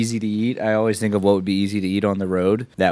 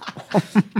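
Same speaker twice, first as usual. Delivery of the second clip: laughing through the Southern voice; 11 semitones higher than usual. Acted. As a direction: crack up mid-line. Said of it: "good Southern accent".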